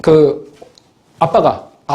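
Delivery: ordinary talk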